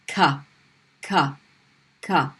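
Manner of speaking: ka with mid tongue on the roof; light ka. Shown as a demonstration. Teacher demonstrates ka for students. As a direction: model the English-like ka. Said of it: "middle of the tongue".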